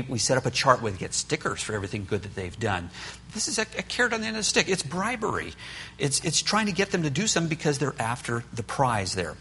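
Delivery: ordinary delivery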